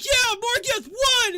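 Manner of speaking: high, full-force, drawn-out scream; crowd screaming. Acted. Fans celebrating the winner, screaming. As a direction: scream it like a fan whose fighter won. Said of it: "they start screaming".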